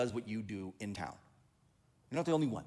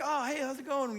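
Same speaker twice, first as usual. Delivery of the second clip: friendly greeting; 10 semitones higher than usual. Retold or imitated. Pastor acting friendly conference chat.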